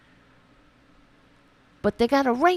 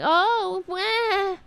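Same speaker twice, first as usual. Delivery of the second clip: high, whiny mock voice of a complaining conservative; mocking whiny right-winger; 10 semitones higher than usual. Host mocking right-wingers in a whiny high voice. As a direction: whine it out like an offended troll. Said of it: "they're like".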